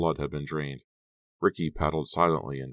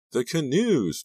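high, louder wail; distressed exclamation; 11 semitones higher, 5 dB louder, slower than usual. A narrator's reading. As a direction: start to wail in dismay. Maya upset about the missing canoes.

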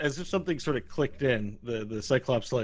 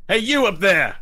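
high, loud holler; calling up; 10 semitones higher, 12 dB louder than usual. Acted. Sheriff retrying his call from below, calling up. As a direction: holler up from street level. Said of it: "You hear a voice from down below".

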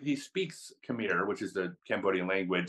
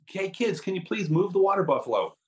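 high, raised retold call; calling out to kids; 9 semitones higher, 4 dB louder than usual. Jacob again yelling to kids at the hole, high mock call. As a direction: hail the kids from a distance. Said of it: "so yells to the kids".

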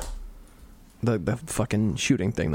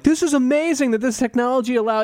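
much higher, gushing enthusiasm; mock gushing; 13 semitones higher, 4 dB louder, faster than usual. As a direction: gush like a starry-eyed fan. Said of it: "were like".

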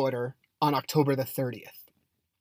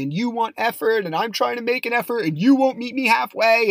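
mock yell; 6 semitones higher, 3 dB louder than usual. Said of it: "screaming at me".

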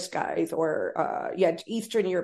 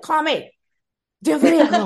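impatient; 7 semitones higher, 7 dB louder than usual. Retold or imitated. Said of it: "I'm like".

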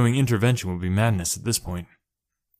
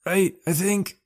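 higher, muffled as if from inside a locker; 10 semitones higher, slower than usual. A narrator's reading. Trapped boy answers muffled from inside locker. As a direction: speak through the locker grating. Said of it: "a muffled voice said through the locker grating".

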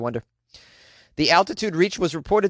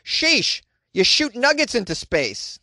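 sheesh; 7 semitones higher, slower than usual. Real, not acted. Mock disbelief at nugget launch.

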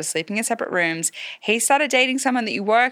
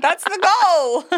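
laughing; 10 semitones higher, 4 dB louder, much slower than usual. Real, not acted. Laughing at her own joke.

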